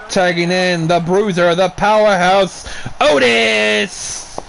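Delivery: lively ringside wrestling call; play-by-play call. Acted. Commentator calling the wrestling game's moves live, energetic play-by-play.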